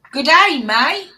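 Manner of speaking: a quick hammed-up Australian greeting; jokey Aussie accent, not very good; half-hearted Australian accent; mock Australian. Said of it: "Please don't do an Australian accent".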